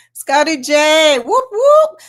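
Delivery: loud call, then high whoops; cheering shout-out. Real, not acted. Host greeting a favourite viewer, loud high whoops. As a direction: hype up a viewer by name.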